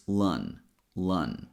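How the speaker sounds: "lun" low and relaxed, with an uh vowel; relaxed schwa syllable. Shown as a demonstration. Teacher models the second syllable of calendar, relaxed "lun". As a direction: let "lun" drop, a loose L, schwa, N. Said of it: "will have a very relaxed sound".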